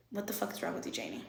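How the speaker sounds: hushed disbelief. Retold or imitated. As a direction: drop to a near-whisper, imitating dismayed friends. Friends' dismayed reaction, retold.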